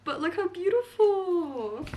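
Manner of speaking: slow, high, hushed admiration; awed. Real, not acted. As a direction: marvel at something lovely. Showing off the new book.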